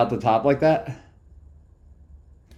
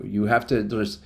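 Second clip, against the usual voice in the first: ordinary talk, nothing marked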